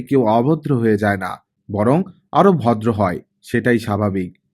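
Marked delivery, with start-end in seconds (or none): none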